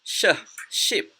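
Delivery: "sh" alone, then "ship" after a pause; teacher modelling "sh, ship" piece by piece; sh sound demo. Shown as a demonstration. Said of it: "This one is ship".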